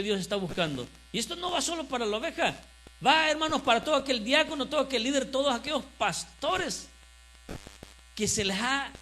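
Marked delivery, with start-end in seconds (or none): 1.1-8.9 preach. Real, not acted. Pastor gives the church its message, preaching emphatically. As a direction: proclaim the verse to the congregation.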